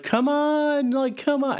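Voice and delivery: high pleading urging; high-pitched mock plea to the horse.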